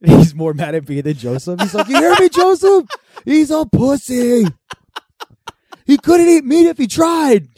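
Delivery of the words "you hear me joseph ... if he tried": high, loud, stretched-out taunt; dad yelling next door. Retold or imitated. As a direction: holler it slowly at a neighbor. Dad yelling over at neighbor.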